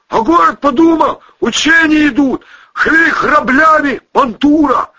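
high, loud enraged yell; shouting. Acted. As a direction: bellow in fury. Shouting under fire.

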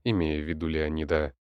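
ordinary delivery

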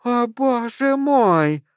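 high, slow, awestruck cry; overwhelmed woman. A narrator's reading. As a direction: stretch it out, stunned and tearful. Crazed woman recognises a dead star.

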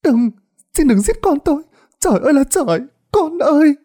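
put-on high, wailing plea for her child; desperate mother wailing; tearful begging. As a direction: beg through tears. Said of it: "nước mắt giản giụa".

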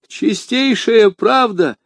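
high, slow, anxious babble; a scared shopkeeper agreeing; высокий растерянный лепет; babbling. A narrator's reading. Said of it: "пролепетал галантерейщик".

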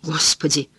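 plain reading voice

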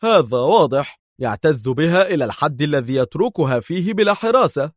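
curt, cutting retort; pointed, moderately heated rejoinder; sharp. A narrator's reading. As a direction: throw it back sharply. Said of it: "قال دار في حدة".

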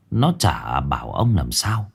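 usual speaking voice

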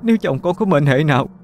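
high-pitched tearful begging; acted wailing entreaty, climbing in pitch; sobbing plea. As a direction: beg for a husband's life in a weeping, high voice. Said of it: "rồi khóc nức nở".

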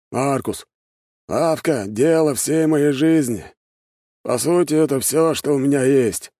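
put-on high-pitched old man's voice, pleading his case; old shopkeeper, earnest. Old shopkeeper explains the shop is his life, earnest.

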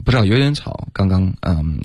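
normal talking voice

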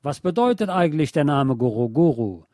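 ordinary talk, nothing marked

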